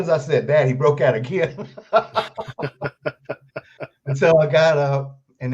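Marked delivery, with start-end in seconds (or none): 1.7-4.0 a real burst of laughter, falling away; hearty real laugh. Real, not acted. Laughing at his own story.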